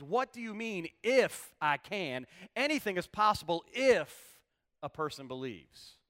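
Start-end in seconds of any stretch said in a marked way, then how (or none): 0.0-2.3 "If" drawn out long and loud in a raised challenge; stress on If. Shown as a demonstration. Jesus throwing the father's words back, "If" heavily stressed. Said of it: "if is not with the can". 2.6-4.4 loud, high line landing hard on "if"; stress on if. Shown as a demonstration. Preacher showing the if belongs to belief, "if" punched. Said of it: "is with the belief".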